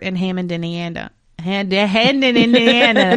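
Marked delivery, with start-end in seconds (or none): 1.4-3.2 polished TV anchor read; newscaster voice. Pretend. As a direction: announce it like the evening news. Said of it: "That was my newscaster voice".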